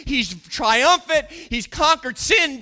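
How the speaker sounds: ordinary delivery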